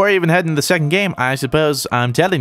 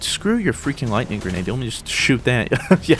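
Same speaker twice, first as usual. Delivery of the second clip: usual speaking voice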